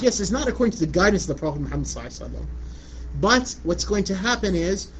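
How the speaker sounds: ordinary talk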